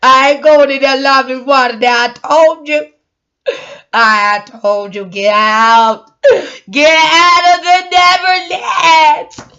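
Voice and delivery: loud, dragged-out half-sung shout; hammed-up banishing yell; playful yelling. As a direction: shout them out of the Neverland, slow. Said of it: "My voice is going".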